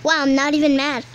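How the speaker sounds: usual speaking voice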